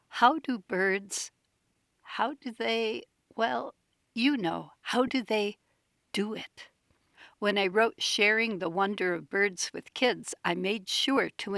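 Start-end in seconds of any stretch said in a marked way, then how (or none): 0.1-6.7 put-on hesitant, slightly higher old woman's quaver; wavering, halting, a bit higher. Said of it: "she said in a quavering voice".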